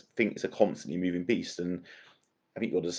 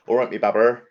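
higher, louder Bristolian greeting; Bristolian accent; 4 semitones higher, 6 dB louder than usual. Shown as a demonstration. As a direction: lay on the Bristol accent for him. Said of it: "give me the accent there".

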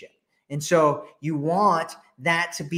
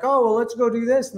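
much higher, put-on chirpy voice; pitched-up cartoon boyfriend proposing a plan; mock eager guy; 8 semitones higher, 4 dB louder than usual. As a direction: imitate the boyfriend cheerfully suggesting. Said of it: "the guy's like".

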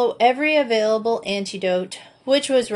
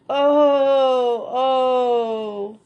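pained groan; 5 semitones higher, 4 dB louder than usual. A narrator's reading. Sick boy groaning in pain.

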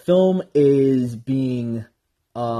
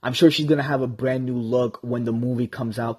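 normal talking voice

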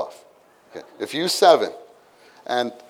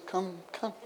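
gentle call; 5 semitones higher, 16 dB quieter than usual. Retold or imitated. Jesus gently calling disciples.

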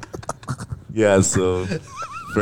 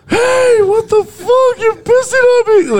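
outraged yell; 8 dB louder than usual. Retold or imitated. Fernie yells at Hector after being pissed on, an outraged yell. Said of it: "you just hear fucking Fernie, like".

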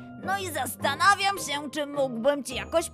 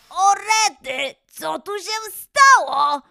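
loud, drawn-out astonishment; stunned discovery; surprised; 3 semitones higher, 8 dB louder, slower than usual. Acted. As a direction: exclaim it higher and louder. Lion startled by sudden lake.

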